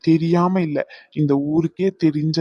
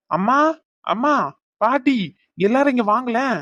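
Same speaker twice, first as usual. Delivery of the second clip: calling out; 7 semitones higher than usual. Acted. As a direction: shout for mother and grandma. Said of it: "அம்மா அம்மான்னு கத்திக்கிட்டு".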